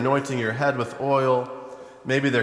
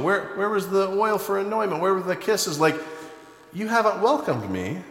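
mock-indignant Jesus; 6 semitones higher than usual. Jesus rebukes Simon the host.

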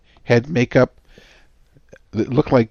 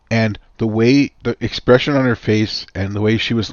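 ordinary talk, nothing marked

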